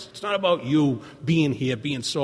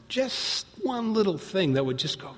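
ordinary talk

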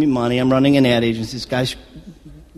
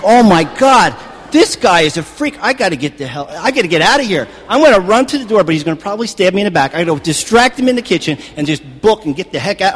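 loud, rushed panicky inner voice; retold panic; 5 semitones higher, 5 dB louder than usual. To himself, deciding how to flee the stranger: panicked, loud. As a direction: replay the terror of being trapped with a freak. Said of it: "I'm, I'm freaking out".

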